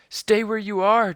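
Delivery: high, loud call to stop; boy shouting to his sister on the ladder to stay back; shouting warning. A narrator's reading. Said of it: "Jack shouted".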